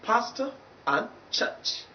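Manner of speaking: "Pastor" with final schwa, "church" with long central vowel; slow model pronunciation. Shown as a demonstration. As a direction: say "Pastor and church" slowly and separately. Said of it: "I hope you get that clear".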